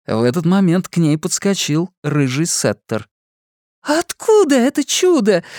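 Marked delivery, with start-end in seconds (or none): 3.8-5.4 high, louder delighted squeal; squeal of joy. A narrator's reading. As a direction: squeal with excitement at the dog. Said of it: "завизжала от радости". (радостный визг)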